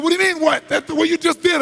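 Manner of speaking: high, loud shouted disbelief; indignant shout. Retold or imitated. Chairman demanding an answer after being hit, loud shout. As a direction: yell it, unable to believe the question.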